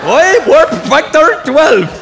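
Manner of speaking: high, slow, garbled mock Scots; Scotty. Engineer to his captain about warp speed, mangled Scottish. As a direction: drag it out in a loud bad Scottish accent. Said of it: "Pakistani guy that had a stroke".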